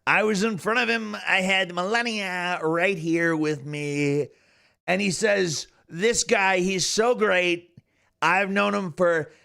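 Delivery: Donald Trump. Retold or imitated. Streamer retelling bit about a businessman, Trump impression. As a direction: imitate the president telling an anecdote. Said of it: "and he's saying".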